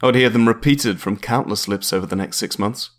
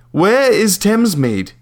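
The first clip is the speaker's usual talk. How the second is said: slow, higher, leaning on "where"; slow, exasperated repeat; 10 semitones higher, 4 dB louder, slower than usual. Acted. Caller pressing the landlady, slow and exasperated. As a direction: drag out "Where" patiently.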